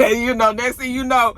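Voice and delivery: ordinary talk, nothing marked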